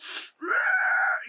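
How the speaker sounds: very high screeching metal squeal; pig squeal metal scream. Shown as a demonstration. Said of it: "like, pig squeal metal".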